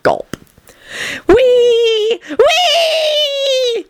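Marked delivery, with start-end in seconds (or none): 1.3-3.8 very high, gleeful whooping with a laugh; hollered whee. A narrator's reading. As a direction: whoop like a child on a swing. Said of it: "he hollered".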